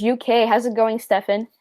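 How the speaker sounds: usual speaking voice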